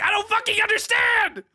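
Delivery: very high-pitched exasperated yell; frustrated shout. Real, not acted. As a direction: let it burst out as a screamed protest. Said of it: "I just have to naturally fucking shout".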